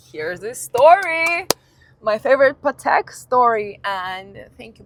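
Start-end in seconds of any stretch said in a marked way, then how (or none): 0.1-3.8 excited. Retold or imitated. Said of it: "And I'm like".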